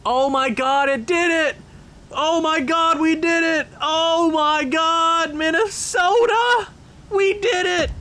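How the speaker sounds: screaming with joy; ecstatic screaming. Real, not acted. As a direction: shout it in pure triumph. Trade accepted, screaming celebration.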